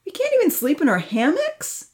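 put-on boy objecting to punishment. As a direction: whine back in a lanky lad's voice. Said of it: "Franz objected".

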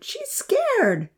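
put-on very high child's voice; gentle plea. Vahid calling out to be kind to Brownie, high voice. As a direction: plead for the scared cow. Vahid asking kindness for cow.

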